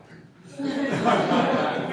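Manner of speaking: loud high laugh; high laughing burst. Real, not acted. Speaker to the room laughing loudly. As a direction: break into laughter. Laughing at his own story.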